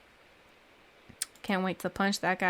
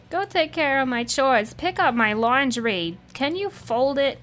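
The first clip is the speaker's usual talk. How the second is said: higher, whiny-bossy mock demands; mock quest giver; 5 semitones higher than usual. Player mocking quest givers who demand chores, higher mock-bossy voice. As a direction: imitate a needy quest giver in a higher pitch.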